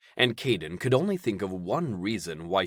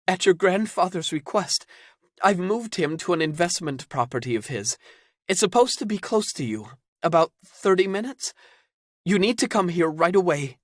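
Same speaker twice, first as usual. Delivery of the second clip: urgent woman voice; 10 semitones higher, 4 dB louder than usual. Acted. Zelda urgent on phone.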